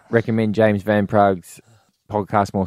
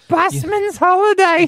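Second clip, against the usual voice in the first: laughing; slower than usual. Real, not acted. Amused echo of odd phrase.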